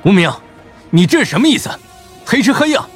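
very high, loud indignant shout; angry outburst. Acted. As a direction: leap up and shout in outrage. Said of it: "豁然站起身怒道".

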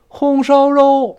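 "红烧肉" very high, loud and very slow; awkward distorted. Shown as a demonstration. Teacher mocking an awkward way no dialect uses. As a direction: strain "红烧肉" into an unnatural tune. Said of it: "没有这么说的".

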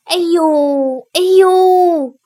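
drawn-out, high "aiyou" wail; acted long cry of pain. A narrator's reading. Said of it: "王忠开始哀嚎起来".

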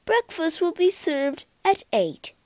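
lightly voiced polite poodle; a polite butler dog. A narrator's reading. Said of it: "the poodle speaking".

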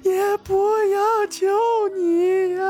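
very high, slow, tearful lament; anguished wail. A narrator's reading. Wife grieving for her granddaughter, wailing in anguish. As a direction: wail it out, dragging each phrase through sobs. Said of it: "夫人凄声说道".

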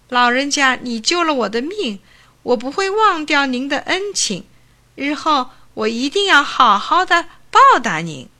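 put-on high, eager young man thanking his saviour; young man gushing gratitude to the old doctor; grateful thanks. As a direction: pour out heartfelt thanks. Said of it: "高兴地向老医生叩头道谢".

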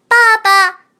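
put-on high, loud baby creature voice; a tiny hatchling calling out; thin reedy voice. Hatchling calls papa.

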